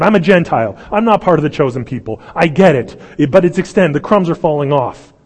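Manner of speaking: loud, high, urgent plea; pleading woman. Retold or imitated. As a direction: beg with everything you have. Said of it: "on the side of the woman going".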